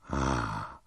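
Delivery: basso e sommesso, un soffio da gigante; esclamazione dell'omone. A narrator's reading. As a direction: esclama piano, voce bassa. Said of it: "esclamò l'immenso uomo". (esclamazione grave e sommessa)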